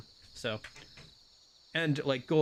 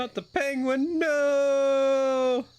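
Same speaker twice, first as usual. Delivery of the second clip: high, loud wail of mock grief; mock despair; 13 semitones higher, 6 dB louder, much slower than usual. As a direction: cry out over the lost penguin. Mock grief over lost cracker.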